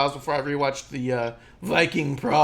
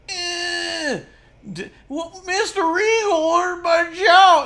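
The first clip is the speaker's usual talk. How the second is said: cartoonish yokel; 7 dB louder, slower than usual. Grimes caught on camera by Regal, cartoon yokel whine. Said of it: "the whole cartoonish thing".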